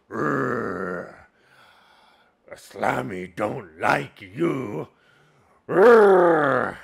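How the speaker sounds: loud ogre growls and slow words; Slammy voice. Shown as a demonstration. Game master voices second ogre disliking players, loud growling. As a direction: growl and roar like an angry ogre. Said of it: "And then you have Slammy".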